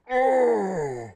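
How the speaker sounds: high, slow, moaning lumbering brute; Hulk. Acted. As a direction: groan and gasp in hammed-up agony. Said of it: "walking around like a thundering Hulk going".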